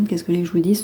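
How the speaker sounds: usual speaking voice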